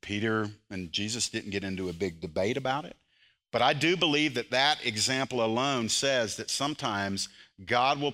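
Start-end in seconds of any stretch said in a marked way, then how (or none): none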